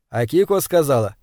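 ordinary delivery, nothing marked

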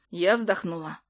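ordinary delivery

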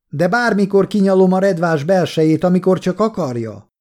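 high-pitched taunting yell; shouting. A narrator's reading. Kolja carries on insulting the soldiers outside, shouting. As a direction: keep the insult carrying outside. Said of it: "kiabálta".

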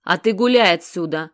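loud, rude dismissal; rough bark. A narrator's reading. Sasha shooing Genych away, a rough bark. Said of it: "грубо рявкнула Сашка".

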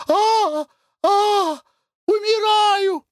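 piercing high scream, slow; screaming in fear. A narrator's reading. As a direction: shriek "умираю" in terror. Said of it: "пронзительный крик Глафиры Семёновны".

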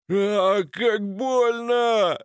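high, drawn-out cry of pain; writhing in pain. A narrator's reading. Wounded Slavik writhes on the floor, wailing in pain. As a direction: stretch the words out in high-pitched agony. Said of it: "подтвердил корчащийся Славик".

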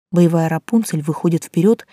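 usual speaking voice

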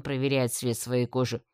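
usual speaking voice

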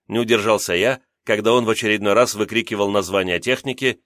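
ordinary delivery

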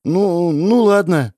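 slow, hesitant, halting okay; boy reluctantly agrees, slow and unsure; unsure agreement. Acted. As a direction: agree without conviction. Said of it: "без особой уверенности ответил Эдгар".

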